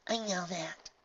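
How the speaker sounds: put-on young filly voice. A narrator's reading. Filly replies.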